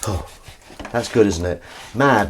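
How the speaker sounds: ordinary talk, nothing marked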